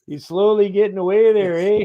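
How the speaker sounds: lampooning a Canadian watching his dog leave; Canadian accent. Retold or imitated. Friend mocking a Canadian dog owner, high Canadian accent. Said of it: "He's like".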